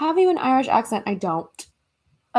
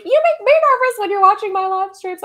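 higher, louder mock protest; high-pitched imitated complaint; imitated nervous friend; 10 semitones higher, 8 dB louder than usual. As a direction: squeal it back in a friend's voice. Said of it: "she is always like".

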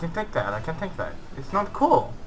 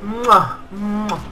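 exaggerated kiss sound, twice; playful "mwah" kisses; 5 semitones higher than usual. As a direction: blow two big noisy kisses. Blows kisses to the bots.

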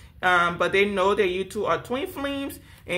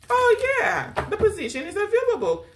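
high-pitched mock-eager recruiter; eager employer; 13 semitones higher than usual. Employer welcoming an applicant to the blocking position, mock-eager and high-pitched. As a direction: squeal it like a job is wide open.

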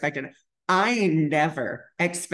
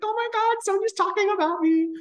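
very high giddy gushing; retold high-pitched excitement; starstruck squeal. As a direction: squeal like a thrilled fan. Retold thrill at idol's praise.

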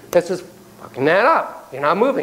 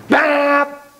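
high, sudden horn blast; imitated car honk; 11 semitones higher than usual. Shown as a demonstration. Driver behind you at the stoplight, sudden imitated honk. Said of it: "Person behind you honks at you".

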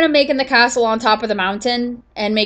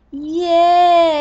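bright little yay of delight; excited cheer; 7 semitones higher, 4 dB louder than usual. Real, not acted. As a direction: cheer at the find. Excited underwater find.

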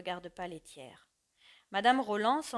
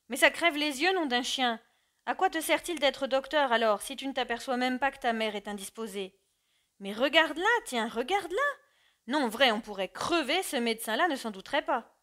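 loud, high, furious outburst; angry scolding; 4 semitones higher, 6 dB louder than usual. Acted. Father scolds his doctor son, angry and loud. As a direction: berate the son who failed to notice. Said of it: "Alors Roland se fâcha".

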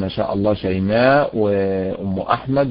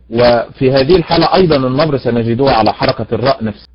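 usual speaking voice

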